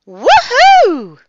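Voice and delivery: very high, loud whoop; excited yell. A narrator's reading. Ian and Olivia at the thunder, excited yell. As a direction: let out a big swooping cheer. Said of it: "Ian and Olivia yelled".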